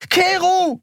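shrill outraged scream of the name. Acted. Endriel screams as Keru grabs her. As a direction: shriek Keru at him. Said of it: "schrie sie".

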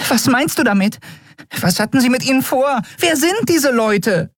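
very high-pitched, agitated questioning; squeaky little creature in a flap; hysterical fairy. A narrator's reading. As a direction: fire frantic questions in a tiny high voice. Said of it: "umschwirrte hysterisch sein Löwenhaupt".

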